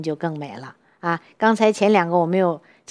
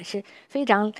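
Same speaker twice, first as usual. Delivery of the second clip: ordinary talk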